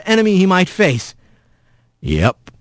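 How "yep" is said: very low, laconic rumble; calm rough voice. A narrator's reading. Mysterious voice answers battling Rat, low and laconic. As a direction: drop it deep and easy. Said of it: "the voice said".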